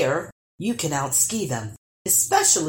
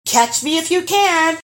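loud, much higher taunting call; 11 semitones higher, 6 dB louder than usual. A narrator's reading. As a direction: shout the dare across the snow. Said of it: "he called out".

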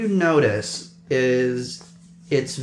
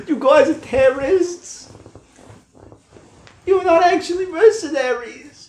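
high guard voice. Acted. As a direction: squeak it out accusingly. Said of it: "He's like".

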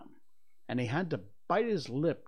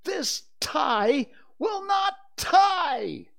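slow, high, loud exasperation; furious yell; 9 dB louder, much slower than usual. A narrator's reading. Father rages at his tie, yelling slowly. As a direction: yell it out word by word. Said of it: "He really yelled".

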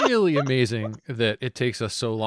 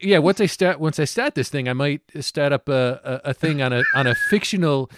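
gangster boast; 4 semitones higher, 7 dB louder than usual. Acted. Gangster boasting about crime plans.

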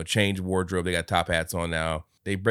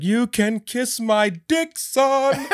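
high, slow hammed-up character voice; mock old-timey rasp; 5 dB louder, much slower than usual. Scrooge ending the song with an insult, slow high mock voice. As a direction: drag out the insult theatrically.

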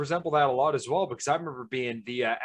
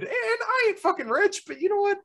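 much higher, laughing and near tears; emotional. Real, not acted. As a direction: overcome with happy emotion, half laughing, half crying. Emotional about his own happiness.

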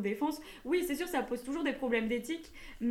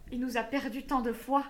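ordinary delivery

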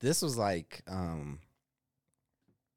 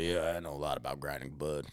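put-on lower, softer old smoker's voice; imitating coworker Mike; 5 semitones lower, 4 dB quieter than usual. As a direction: do the burly old chain smoker, low and easy. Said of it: "he spoke like that".

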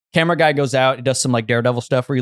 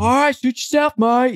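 high, breezy Australian voice; mock Australian accent; 13 semitones higher than usual. Australian instructor shrugging off the refusal, high mock Australian. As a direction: toss it off like a carefree Australian instructor. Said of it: "Australian or something".

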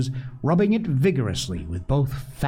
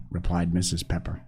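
ordinary delivery

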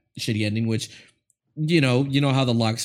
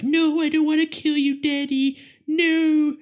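put-on high pleading little girl; whiny daughter. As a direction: beg like a tearful little girl. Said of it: "And she's like".

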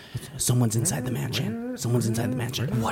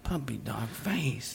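soft slow aside; 10 dB quieter, slower than usual. Acted.

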